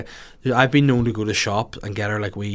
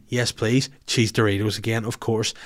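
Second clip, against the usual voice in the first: ordinary talk, nothing marked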